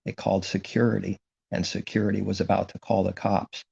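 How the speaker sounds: normal talking voice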